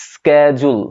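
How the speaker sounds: 'schedule' as SKED-jul; drawn-out American 'schedule' with a hard k; American pronunciation. Shown as a demonstration. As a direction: begin 'schedule' with sk. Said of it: "আমেরিকানরা এটাকে বলে".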